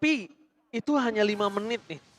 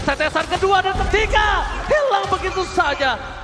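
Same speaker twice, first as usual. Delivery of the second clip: hype play-by-play; 11 semitones higher, 5 dB louder than usual. Real, not acted.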